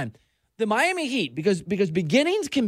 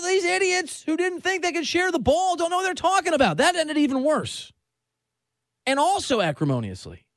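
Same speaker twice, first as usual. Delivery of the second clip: ordinary talk, nothing marked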